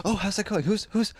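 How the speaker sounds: high, breathy feigned enthusiasm; fake excitement. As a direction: act thrilled but soft. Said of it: "I would feign, like, the most extreme excitement".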